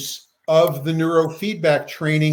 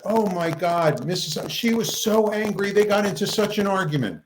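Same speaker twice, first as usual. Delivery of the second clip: higher, breathless retelling of a shock; upset research assistant; 6 semitones higher than usual. Retold or imitated. Assistant back in tears, reporting the fight, mildly upset. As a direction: voice the assistant, still shaken.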